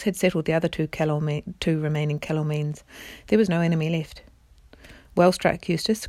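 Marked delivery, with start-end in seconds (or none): none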